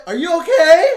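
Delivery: high, loud worried squeal; alarmed concern. Retold or imitated. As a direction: squeal at the fallen bear in alarm. Bear snaps neck in the game.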